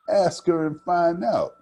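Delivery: high, loud sassy challenge; cheeky girl's dare; sassy retort. Acted. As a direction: toss it off and strut away. Mirandy taunts and struts off.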